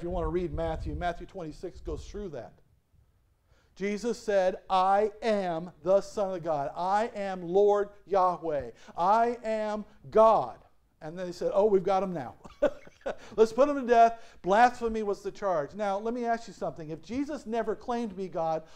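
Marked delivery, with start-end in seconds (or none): 4.7-10.6 slowing to a crawl, growing louder to the end; emphatic declaration. Acted. Pastor voicing Jesus' bold claim. 11.5-14.2 louder, gleeful and scheming, with a laugh; mock gloating priests. Voicing priests gloating over Jesus.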